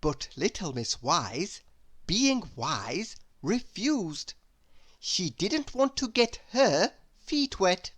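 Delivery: usual speaking voice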